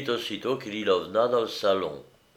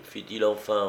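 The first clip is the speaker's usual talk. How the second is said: ordinary delivery, nothing marked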